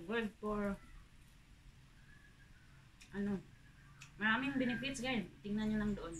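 ordinary delivery

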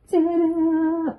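high, bright ta-da for the photo; excited reveal. Real, not acted. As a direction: announce the reveal like a surprise.

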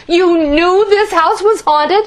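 high, slow and loud; can't believe it; stunned outrage; shocked disbelief. A narrator's reading. Cressa shocked.

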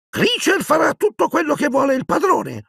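very high, grudging elf voice; Creature, grumbling. Acted. Bowing elf grudgingly accepts his master's job, grumbling. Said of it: "borbottò l'elfo".

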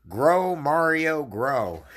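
high, slow yelled Grow, Mario; drawn-out mock shout with a big first Grow. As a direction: bellow it like a drunk at a party. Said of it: "started yelling".

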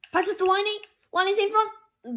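high, loud urgent call; excited play-by-play. Real, not acted. Gamer calls pass to Laine in front, excited call. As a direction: raise the pitch as the chance builds. Live call of scoring chance.

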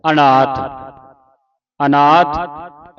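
ਅਨਾਥ with a clear opening "a", loud; audible initial vowel. Shown as a demonstration. Teacher models the first letter, ਅਨਾਥ with clear "a-naath". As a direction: sound the "a" before n in ਅਨਾਥ, drawn out. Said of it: "ਐੜੀ ਦੀ ਆਵਾਜ਼ ਉਚਾਰਨ ਕਰਨੀ ਹੈ".

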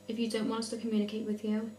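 ordinary talk, nothing marked

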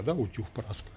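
usual speaking voice

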